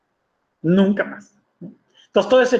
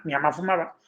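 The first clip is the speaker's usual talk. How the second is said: usual speaking voice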